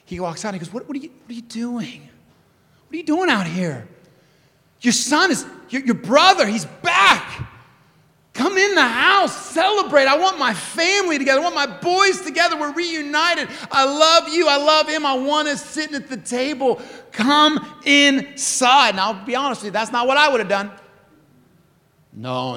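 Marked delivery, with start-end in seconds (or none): 0.7-7.5 baffled dad building in urgency; pleading father. Acted. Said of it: "he pleaded with his boy". 8.3-19.0 high, loud, urgent plea; entreating father. Acted. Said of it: "that he entreated his boy".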